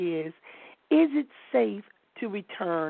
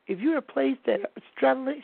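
ordinary talk

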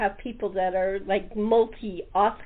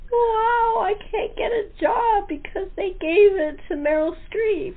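high, crying mock complaint; hammed-up sob of an actor losing a job; mock whining; 10 semitones higher than usual. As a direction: whine it like a wronged actor. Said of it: "you're like".

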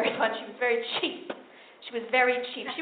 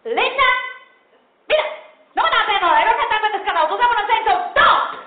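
loud, high yelling mother; loud, high Puerto Rican mom screaming; 8 semitones higher, 7 dB louder than usual. Retold or imitated. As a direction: yell at the child in a rush. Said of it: "just start screaming".

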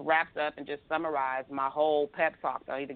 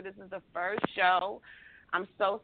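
usual speaking voice

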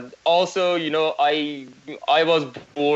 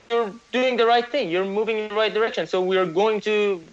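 higher, sing-song pet praise, like rewarding a puppy; praising a good dog; 7 semitones higher than usual. Pretend. FIFA praising a reforming country like a pet, mock sing-song praise. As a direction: coo it as if handing a dog treats. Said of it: "to use dog metaphors".

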